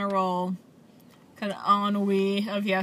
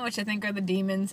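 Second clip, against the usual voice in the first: normal talking voice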